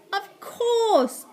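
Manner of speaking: high, excited acted cry; delighted agreement. A narrator's reading. Slime agrees with the boy's plan, excited. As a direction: jump on the idea eagerly. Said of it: "agreed Slime".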